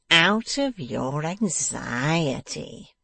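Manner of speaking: slow, drawn-out sneer; gloating, slow disbelief; grinning mockery. A narrator's reading. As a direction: echo it back mockingly with a grin. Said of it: "grinned the dwarf".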